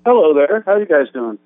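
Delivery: tinny phone line, no bass; thin voice no bass. Real, not acted. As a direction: sounds like a phone in a garbage can. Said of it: "You sound like you're on a phone or in a garbage can".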